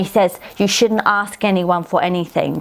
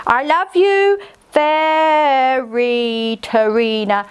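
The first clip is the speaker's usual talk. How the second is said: slow dictation; 4 semitones higher, much slower than usual. Pretend. Dictates loving line.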